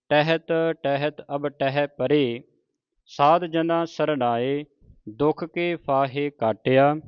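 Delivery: slow, drawn-out Gurbani recitation; scripture recitation. Shown as a demonstration. Teacher models scripture for the congregation, slow measured recitation. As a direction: recite each word slowly with long vowels.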